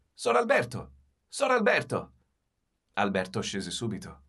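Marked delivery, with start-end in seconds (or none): 0.1-2.1 high, loud call across the lawn. A narrator's reading. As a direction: shout the name from afar. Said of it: "chiamandola a voce alta ... gridava". (chiamata ad alta voce da lontano)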